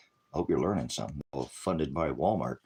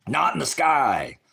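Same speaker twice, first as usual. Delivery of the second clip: mock shout; 7 semitones higher, 7 dB louder, slower than usual. Sudden mocking exclamation.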